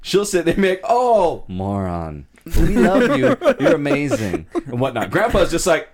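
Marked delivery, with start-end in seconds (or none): 0.9-4.5 high, loud, laughing grandma gushing; doting grandma. Retold or imitated. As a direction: gush over the grandkid through laughter.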